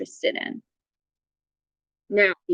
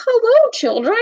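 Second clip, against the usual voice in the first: high, sweet librarian voice; a high storybook librarian voice; librarian greeting; 12 semitones higher, faster than usual. Acted. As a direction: sing out a greeting to the children.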